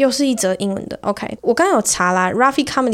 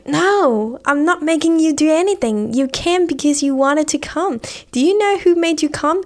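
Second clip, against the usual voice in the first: indignant lecturing; 7 semitones higher, slower than usual. Retold or imitated. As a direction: set her straight like it's obvious. His indignant reply in the joke. (高声、假装义正词严)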